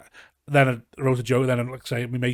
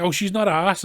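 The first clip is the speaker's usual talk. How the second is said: high, louder careless cousin voice; mock cousin; 7 semitones higher, 6 dB louder than usual. Cousin brushing off the grandson's complaint, loud and careless. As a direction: shrug it off loudly as the cousin.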